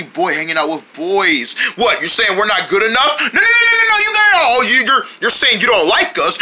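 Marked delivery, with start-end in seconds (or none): 3.3-4.6 panicked protest. Acted. Protesting his friends' accusation.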